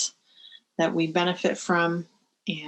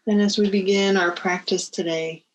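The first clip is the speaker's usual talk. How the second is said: guided meditation. Real, not acted. Teacher settling listeners before the bell, guided meditation.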